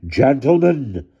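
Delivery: high, loud, drawn-out address; calling out. A narrator's reading. Aunt Frankenstein addresses the villagers, calling out high. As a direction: call the crowd to order. Aunt calls to shouting crowd.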